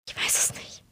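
a very soft, breathless whisper. Acted. Katharina answers Luca's serious look in a whisper. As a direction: barely voice the words, whispered. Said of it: "Schon wieder flüstere ich".

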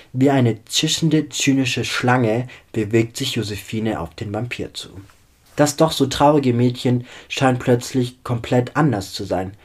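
ordinary delivery

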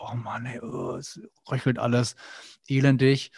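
croaky, miserable cold-sufferer voice; mock sick moaning. Imitating himself whining with a cold, croaky and miserable. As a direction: play the moaning sick man, half in jest. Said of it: "ich bin auch manchmal erkältet".

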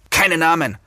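narrated irritated warning; hissed rebuke. A narrator's reading. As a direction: cut her off with a mock hiss. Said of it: "zischte der Mann".

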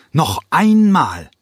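high, loud, drawn-out call of Noch einmal; barely holding back a scream; sharp call. A narrator's reading. Cranko ruft, kurz vor Schreien. (Cranko ruft laut und gedehnt den Tänzern zu)

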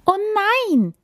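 little deer crying out; acted cry of dismay; alarmed cry. A narrator's reading. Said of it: "rief das kleine Reh". (erschrocken gerufen)

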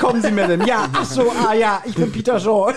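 high, laughing mock-charm; hammed-up gallant greeting. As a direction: play the suave gentleman, laughing. Acting Peter greeting the lady.

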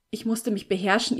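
plain reading voice

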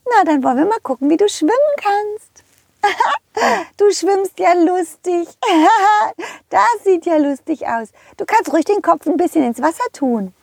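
squeaky high cartoon horse calling out. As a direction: play a cheerful high-pitched pony. Rosa the horse speaks. (hohe quietschige Pferdestimme)

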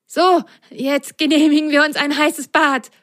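high, satisfied little voice; pleased sock voice. Acted. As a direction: relish the hot bath plan. (zufriedene hohe Stimme)

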